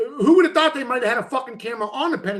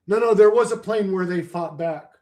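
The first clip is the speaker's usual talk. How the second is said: usual speaking voice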